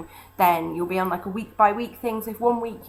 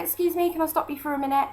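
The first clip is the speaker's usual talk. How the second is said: high, quick friendly pitch; bright stop-you-on-the-street ask; street fundraiser pitch; 9 semitones higher, faster than usual. Retold or imitated. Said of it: "I said".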